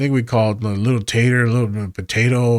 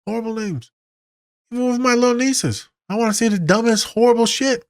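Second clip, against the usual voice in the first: high comic whine; 12 semitones higher than usual. Real, not acted. Therapist joking about his meanness, high whiny voice. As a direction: voice pushed high in mock despair.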